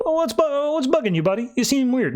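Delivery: high-pitched, needling query; mock concern. Rao asking Hal what bugs him, mock concern. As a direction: raise the pitch right up to probe.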